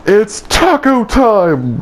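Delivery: high, slow, drawn-out heroic call; mock battle cry. Dreamer hurling an arm at Ice King, mock battle cry. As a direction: stretch it out like a catchphrase.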